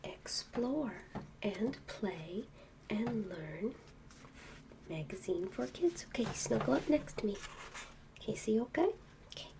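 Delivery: soft quiet storybook reading; bedtime reading. Acted. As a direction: read softly to a child in bed. Reading book to child.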